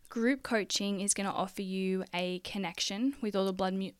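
usual speaking voice

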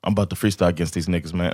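quiet fast buildup before the battle; lightly voiced hushed friend, fast; imitating friend. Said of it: "he was like".

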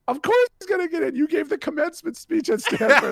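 high, loud, laughing disbelief; incredulous outburst. Retold or imitated. Guest telling a worried father of course he'll get in, incredulous. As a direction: protest loudly, breaking into laughter.

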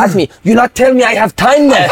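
high-pitched, foreign-accented mock rant; mock angry outburst. Manager mad at player in training, mock fury. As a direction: imitate an irate foreign manager. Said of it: "The Cannots go mad at me".

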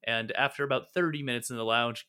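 usual speaking voice

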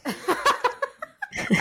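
high seductive villain laugh; sultry laugh. Acted. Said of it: "Se escucha una voz sensual".

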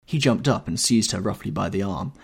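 ordinary delivery, nothing marked

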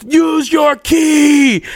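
slow, drawn-out shout, pitched well up; imitated shout. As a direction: bellow it one stretched word at a time. Rowdy yelling through locked door.

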